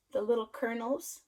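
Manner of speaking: usual speaking voice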